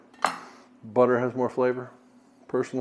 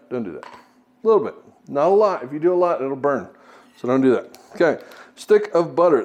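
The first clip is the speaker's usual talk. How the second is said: ordinary talk, nothing marked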